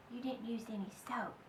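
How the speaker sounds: quick, soft whispered scolding; mother catching her out under her breath; fast voiceless whisper; hushed. Acted.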